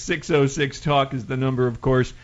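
ordinary talk, nothing marked

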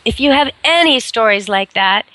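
usual speaking voice

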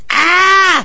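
sharp high squeal; a little dog yelping loudly. Retold or imitated. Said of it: "a Bichon ... going".

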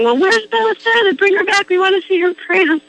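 high mock shout; paparazzi calling out. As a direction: call out like the paparazzi. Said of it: "the paparazzi were saying".

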